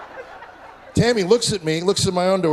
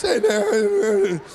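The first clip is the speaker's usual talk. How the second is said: put-on slow, lightly higher boy's voice; drawn-out greeting from a teen who never broke; teen son greeting dad, slow and lightly higher; kid's unbroken voice; 4 semitones higher than usual. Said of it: "This man-child's voice never dropped".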